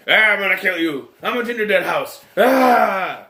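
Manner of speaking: mock villain yell, ending in a shout; Gary Busey. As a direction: threaten like a hammed-up movie killer. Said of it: "Gary Busey's voice".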